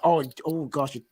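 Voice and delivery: usual speaking voice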